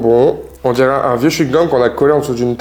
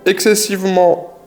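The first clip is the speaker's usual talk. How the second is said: mock disgust; 7 semitones higher, slower than usual. Mock-disgusted product intro.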